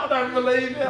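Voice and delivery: high, laughing mock disbelief. The artist doubting he works there, high laughing disbelief.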